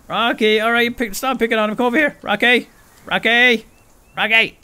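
loud high calls to the pet; calling out. Real, not acted. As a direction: call the runaway pet back loudly.

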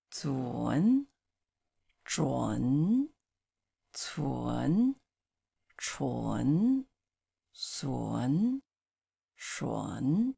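zun zhun cun chun sun shun, each clearly said; careful slow syllable drill for students; Mandarin syllable drill. Shown as a demonstration. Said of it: "Okay, one more time".

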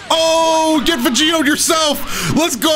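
high, slow excited yell; hyped high shout. Real, not acted. Gamer cheering as Vegito turns the fight, high and hyped. Hype after winning exchange.

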